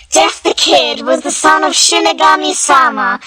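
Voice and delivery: plain reading voice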